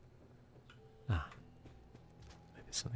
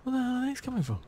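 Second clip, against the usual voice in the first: loud, high-pitched exclamation under attack; exasperated yelp; 13 semitones higher, 9 dB louder than usual. Real, not acted. Game ambush by dogs.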